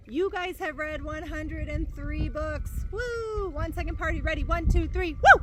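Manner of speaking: teacher cheers her class for a hundred three books; excited cheer. Real, not acted. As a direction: whoop it up with the class, building to a shout.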